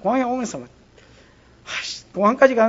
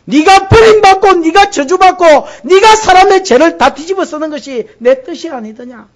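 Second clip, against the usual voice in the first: fervent preaching; 10 semitones higher, 12 dB louder than usual. Acted. Preacher to congregation on Abraham, loud fervent preaching.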